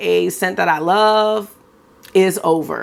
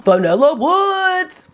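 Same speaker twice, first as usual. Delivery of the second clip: swooning; 9 semitones higher, 3 dB louder, slower than usual. Real, not acted. Swooning over a favorite scent.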